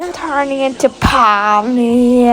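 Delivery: loud, slow, strained yell; meme scream. Retold or imitated. Quoting the Pomni meme, a loud drawn-out scream. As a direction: belt it out like the meme. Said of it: "like, screaming".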